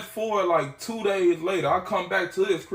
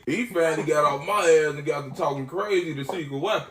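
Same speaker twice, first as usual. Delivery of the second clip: ordinary delivery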